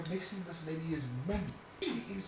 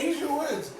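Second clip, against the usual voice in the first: sassy retort; 12 semitones higher, 6 dB louder, slower than usual. Retold or imitated. Retold retort to mom.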